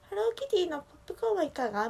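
high, quick mascot announcement; Hello Kitty. Retold or imitated. Mascot calls passers-by to the popcorn machine, high and quick. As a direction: pipe up as a cute vending-machine mascot. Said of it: "キティちゃんが言っててね".

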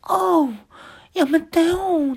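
very high, very slow squeaky plea; timid protest. Retold or imitated. Jacob weakly protesting as his pants are torn, squeaky. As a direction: whimper it like a scared weakling. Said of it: "弱気".

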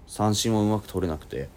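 ordinary delivery

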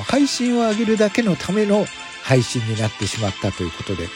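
ordinary talk

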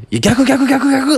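high, loud "逆、逆" yelled in alarm; frantic shout of warning. Retold or imitated. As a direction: yell that the pen is upside down. Everyone yelling the pen is reversed.

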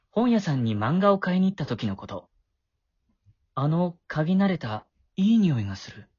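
low, calm narration; story narration. A narrator's reading. Boy narrates between scenes. (低く落ち着いた語り)

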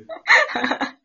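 a sudden loud, high laugh. Real, not acted. Laughing at own nervousness.

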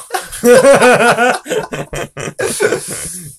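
a big real laugh; loud laugh. Real, not acted. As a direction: burst out laughing loudly. Big laugh at blog title.

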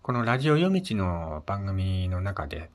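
ordinary talk

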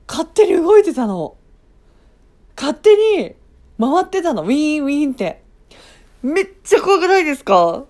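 high, loud, agitated telling; excited. Real, not acted. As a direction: push it up, worked up and loud. Excited retelling of scare.

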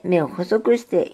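ordinary delivery

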